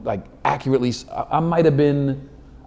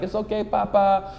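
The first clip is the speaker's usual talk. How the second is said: high, soft pitying coo; consoling women; 7 semitones higher, 5 dB quieter than usual. Acted. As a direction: imitate women gently consoling a flopped comic. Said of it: "Chicks walking out like".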